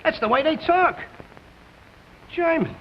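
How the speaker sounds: a contemptuous jeer at the foreigner; sneering contempt. Acted. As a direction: spit out the slur with contempt. Workers sneering at foreman.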